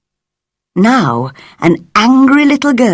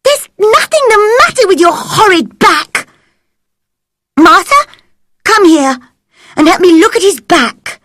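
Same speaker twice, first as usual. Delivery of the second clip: angry command; 13 semitones higher, 3 dB louder, faster than usual. A narrator's reading. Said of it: "serious and angry".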